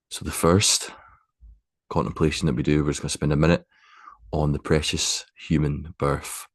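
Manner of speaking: unhurried, spacious guidance, letting each phrase settle; guided meditation. Acted. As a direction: guide them gently into stillness, phrase by phrase.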